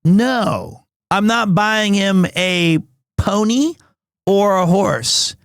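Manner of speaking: usual speaking voice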